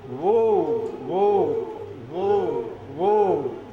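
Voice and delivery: loud drawn whoa calls; train whistle call. Acted. Train sound in story.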